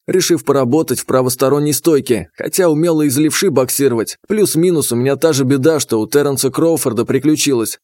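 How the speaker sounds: usual speaking voice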